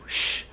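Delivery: short voiceless sh; som não vozeado. Shown as a demonstration. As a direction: push sh through the teeth. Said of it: "e com os dentes". (sh com os dentes, sem voz)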